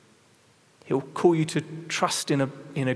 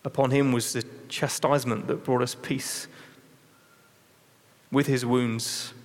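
scripture reading. Acted. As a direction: lay each line down gravely, letting it sink in. Reads Isaiah fifty-three.

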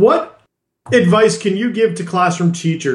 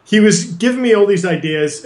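normal talking voice

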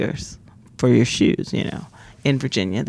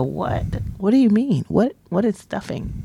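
baffled reaction; 6 semitones higher than usual. Retold or imitated. People baffled by her word.

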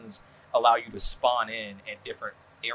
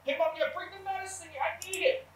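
put-on much higher, squeaky kid yelling to his mom off mic; mocking gamer kid. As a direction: play the random player shouting for mom. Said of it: "That was, that was really good".

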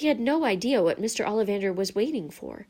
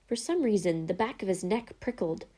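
usual speaking voice

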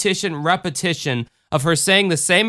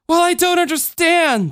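put-on high-pitched indignant little girl; indignant child; 4 dB louder than usual. A narrator's reading. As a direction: squeal it like an affronted small child. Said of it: "the little girl was indignant".

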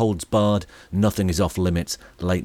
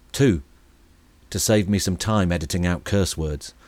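ordinary delivery

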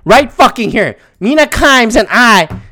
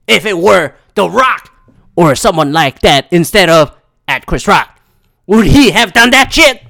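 dramatic pundit tirade with punched words; Stephen A. Smith; 4 dB louder than usual. Acted. Pundit shames Will Smith over a joke, emphatic and dramatic. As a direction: declaim it like an indignant TV sports commentator. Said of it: "dramatic reading that I had to do of Stephen A's Twitter".

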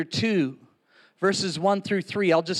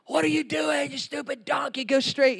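mock furious yell at a stubborn donkey, higher; an exasperated rider losing it at his donkey; angry prophet yelling; 5 semitones higher, 4 dB quieter than usual. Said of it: "And he's like".

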